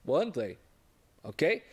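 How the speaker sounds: ordinary talk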